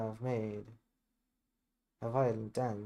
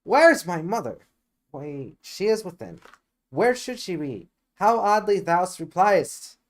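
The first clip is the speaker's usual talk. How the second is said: loud, higher, indignant repeat of the question; exasperated outburst; 5 semitones higher, 10 dB louder than usual. Acted. Juliet indignant at the nurse's odd reply, loud and exasperated. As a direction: fling the words back at her, baffled and cross.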